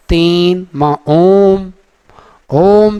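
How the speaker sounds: ओम drawn out very slowly, three beats; pluta om. Shown as a demonstration. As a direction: chant ओम long and ringing. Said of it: "ऐसे लिखा हुआ".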